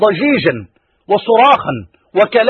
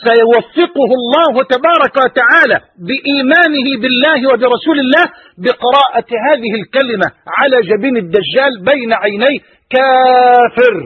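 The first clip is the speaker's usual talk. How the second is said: ordinary delivery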